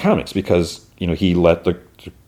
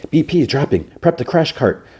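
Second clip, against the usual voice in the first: urgent doctor; 7 semitones higher than usual. Retold or imitated. Doctor voiced in recap.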